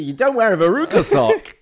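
high, loud disbelieving laugh; can't believe it, laughing; incredulous. Pretend.

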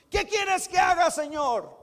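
urgent plea. Pretend. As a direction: call out the question as a desperate plea. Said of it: "se rinden ante Él y dicen". (súplica fuerte y urgente)

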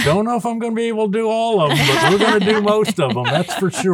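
chuckling through the words, fast and high; laughing. Real, not acted. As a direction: jolly laugh while promising gifts. Santa jokes about children's wishes.

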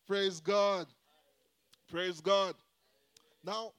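ordinary talk, nothing marked

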